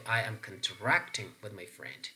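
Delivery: "contracting" as con-TRACT-ing; stress on second syllable. Shown as a demonstration. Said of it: "whether this is contract or contract".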